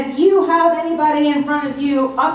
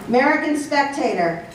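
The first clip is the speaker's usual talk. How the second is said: ordinary talk, nothing marked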